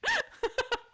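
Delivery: short high giggle; bright squeal of laughter. Real, not acted. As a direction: cackle at your own joke. Laughing after teasing viewers.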